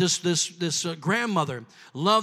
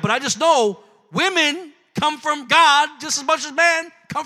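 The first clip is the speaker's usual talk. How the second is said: rising, louder sermon cadence with shouted peaks; preaching at full force; 4 semitones higher, 7 dB louder, slower than usual. Acted. Preacher rousing the congregation about God's blessing, full-force preaching. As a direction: build the congregation up, driving each line home.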